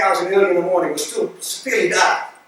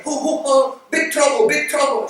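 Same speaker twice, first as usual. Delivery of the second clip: put-on alarmed girl; 6 semitones higher than usual. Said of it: "one of the girls come running".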